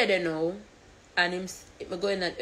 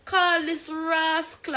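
high-pitched retold scolding of Gio; a sharp, exasperated mock rebuke; 9 semitones higher than usual. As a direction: tell him off in a much higher voice. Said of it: "I say".

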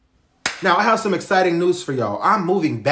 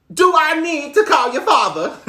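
laughing taunt; 12 semitones higher than usual. Acted.

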